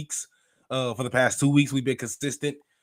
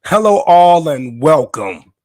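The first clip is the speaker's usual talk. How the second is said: loud, higher hello, drawn-out welcome; big show opening; 5 semitones higher, 11 dB louder, slower than usual. Real, not acted. Host retaking the podcast intro, loud announcer call. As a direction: boom out the podcast greeting. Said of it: "one more time".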